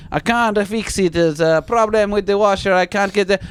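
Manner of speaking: hammed-up Italian plumber; Italian accent. Italian plumber telling tenant he can't fix it, mock accent. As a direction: imitate an Italian handyman making excuses. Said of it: "He's Italian".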